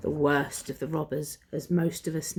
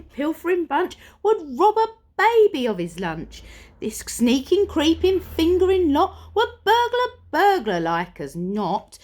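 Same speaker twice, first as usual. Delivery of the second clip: excited building list; 9 semitones higher than usual. Acted. Narrator to listeners, relishing the villains with glee.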